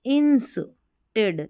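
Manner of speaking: "Instead" split into ins and ted; syllable by syllable. Shown as a demonstration. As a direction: break "Instead" slowly in two. Teacher splits the new word. ("Instead" को दो हिस्सों में तोड़कर)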